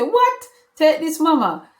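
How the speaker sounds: high shocked cry then offer; astonished. Retold or imitated. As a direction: pounce with surprise then give. Son shocked, giving money.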